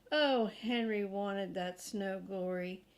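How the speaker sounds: usual speaking voice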